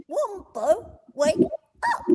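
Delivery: put-on a cheeky young koala trying to wake his friend; Blinky Bill voice. Voice actor reprising Blinky waking Wombo, high cartoon koala voice. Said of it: "And then he goes".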